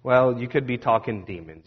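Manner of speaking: ordinary talk, nothing marked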